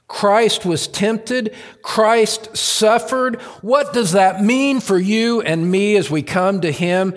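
fervent sermon voice building to a shout; preaching at full force. Acted. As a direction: preach it out to the back row. Proclaims Christ's suffering.

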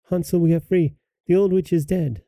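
put-on high, mildly excited girl's cry; joyful cry. A narrator's reading. Gretel freeing Hansel from the stable, high excited cry. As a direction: call out the good news in a high voice. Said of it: "and cried".

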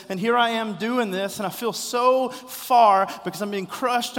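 loud, high pleading cry to a father; anguished cry. Acted. Jesus calling out to his Father, loud and pleading.

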